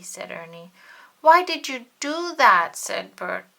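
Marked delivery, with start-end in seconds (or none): 1.2-2.8 much higher, much louder wounded outburst; hurt demand. Acted. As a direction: Bert cries out at his friend. Hurt Bert confronts Ernie.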